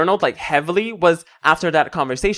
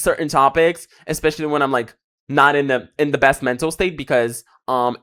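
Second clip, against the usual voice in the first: ordinary talk, nothing marked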